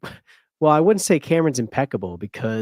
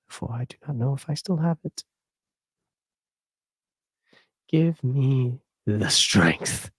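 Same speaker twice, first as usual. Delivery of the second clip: praying for patience through teeth. Pretend. Exasperated prayer for patience.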